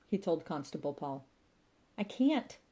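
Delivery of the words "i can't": a higher, lightly voiced wail; a mild narrated wail of despair. A narrator's reading. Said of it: "wailed Constable Paul".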